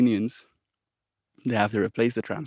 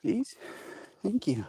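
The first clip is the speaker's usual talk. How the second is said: high soft coaxing dog-talk; 9 semitones higher, 14 dB quieter, faster than usual. Acted. Owner cooing to her puppy, high and soft. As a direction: coo sweetly at the puppy.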